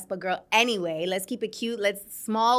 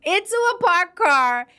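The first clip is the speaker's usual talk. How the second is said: real laughter, higher and louder; laughing while telling; 6 semitones higher, 5 dB louder than usual. Real, not acted. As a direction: laugh through the words at your own mishap. Laughing about own moped crash.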